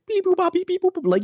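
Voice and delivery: high beeping robot noises; space beeps. Retold or imitated. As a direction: chirp it like a space signal. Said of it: "stereotypical, like, space sound".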